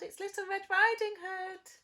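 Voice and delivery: put-on high, loud girl's call; little girl calling. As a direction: call it brightly through the door. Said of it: "she called".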